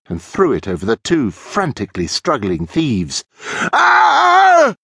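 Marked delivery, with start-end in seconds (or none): 3.7-4.7 loud, very high scream; cowardly thief's shriek of fright; sustained high-pitched "Ah!". A narrator's reading. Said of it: "screamed Stefan".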